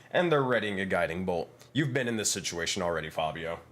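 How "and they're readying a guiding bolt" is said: normal talking voice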